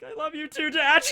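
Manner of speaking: high tearful voice breaking; crying. Retold or imitated. Itachi answering his father through tears, voice cracking high. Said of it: "with tears streaming down his face".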